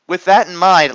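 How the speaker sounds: ordinary delivery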